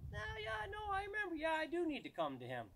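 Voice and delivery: high-pitched put-on voice of a corrected dog; dog giving in. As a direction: squeak it out as a dog suddenly remembering to obey. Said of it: "and it's gonna go".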